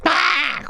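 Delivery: sudden high squawk; bird whistle call. Acted. Bird call starting the animals' race, sudden high squawk. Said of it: "a bird that went".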